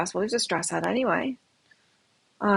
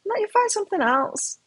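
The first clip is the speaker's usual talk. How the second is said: son's relieved voice; 10 semitones higher than usual. Retold or imitated. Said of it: "He's like".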